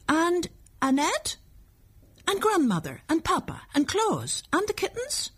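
put-on high child's voice, each item a question; unsure little boy. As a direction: ask hopefully and anxiously as a small child. Unsure small boy lists his family.

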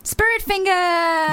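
high, slow drawn-out cheer; mock cheerleader, stretched out high; cheerleader call. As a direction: sing out a cheerleading catchphrase. Imitating film cheerleading call.

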